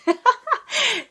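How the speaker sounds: a high burst of real laughter. Real, not acted. Vlogger laughing at her birth clip, a high loud laugh.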